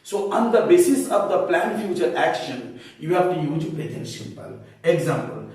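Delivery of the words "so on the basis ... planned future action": normal talking voice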